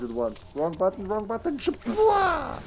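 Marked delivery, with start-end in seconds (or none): 1.6-2.6 high playful boom noise; explosion sound effect. Pretend. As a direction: shout a cartoon blast.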